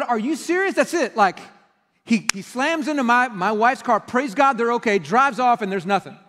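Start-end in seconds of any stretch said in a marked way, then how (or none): 0.0-1.1 indignant disbelief. Real, not acted. Indignant at the outcome.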